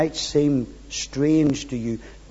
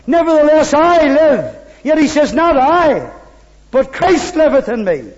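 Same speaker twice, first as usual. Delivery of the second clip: full-force preaching; 9 semitones higher, 7 dB louder than usual. Acted. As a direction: preach it out with ringing conviction.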